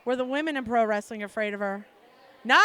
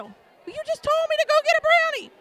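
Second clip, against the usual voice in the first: much higher, loud protest; indignant squeal; 7 dB louder, faster than usual. Retold or imitated. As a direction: whine it in disbelief. Indignant retort in retold call.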